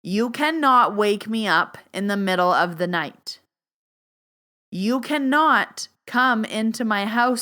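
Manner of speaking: emphatic, stern command; like angry. Shown as a demonstration. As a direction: demonstrate an angry boundary, each word punched. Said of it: "it's like I'm angry".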